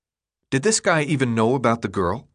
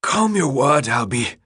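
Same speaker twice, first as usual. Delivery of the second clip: higher, curt brush-off; irritated retort; 3 semitones higher than usual. A narrator's reading. Recovering runner replies to upset Albie, irritated. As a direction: tell them off while recovering breath. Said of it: "the runner replied, seeming to gain strength".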